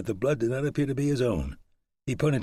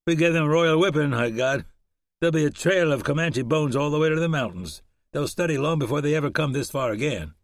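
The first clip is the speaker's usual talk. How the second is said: higher, louder victorious whoop; a hint of battle swagger; triumphant boast; 6 semitones higher, 4 dB louder than usual. Shannon gloating over the Comanche defeat, triumphant.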